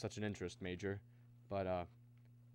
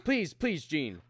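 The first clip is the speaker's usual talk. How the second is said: high, loud, desperate plea; pressing, impatient entreaty; urgent pleading; 5 semitones higher, 6 dB louder, much slower than usual. Acted. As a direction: beg them to stop asking. Cuts off wife's question, pleading.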